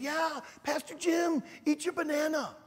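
put-on high sing-song children saying yes; kids' voices. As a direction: mimic the eager kids. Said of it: "And they said".

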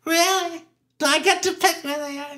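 high, thrilled imitation; Gladys Glover voice. Gladys offered ten billboards, high delighted voice. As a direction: pipe it up like a delighted gal. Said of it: "And she goes".

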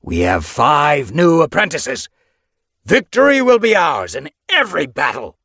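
loud, high, triumphant proclamation; rallying cry. A narrator's reading. Leader promising victory to the clan, rallying cry. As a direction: build to a battle cry.

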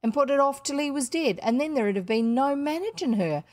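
blunt working-class housekeeper, lively rise and fall; emphatic working woman's voice with dropped letters; working-class Ellen. Acted. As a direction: scold and advise with unrefined vowels. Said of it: "she doesn't have refined vowels".

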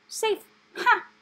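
high, loud scornful "Safe? Ha!"; scoffing. A narrator's reading. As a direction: sneer it out sharply. Rat scoffs.

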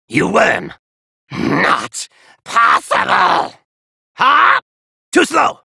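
ordinary delivery

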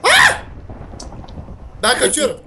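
ordinary talk, nothing marked

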